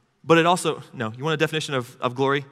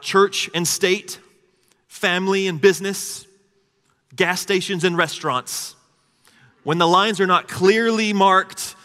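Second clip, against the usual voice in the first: slow, higher, louder preaching; 6 semitones higher, 5 dB louder than usual. Real, not acted. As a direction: build it up like a preacher. Emphatic preaching list.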